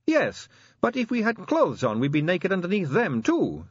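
high-pitched, reasonable objection; lightly voiced constable pointing out the obvious; Sally, logical constable. A narrator's reading.

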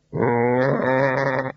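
imitated long, wavering bleat; drawn-out sheep's baa. A narrator's reading. Sheep being sheared answers.

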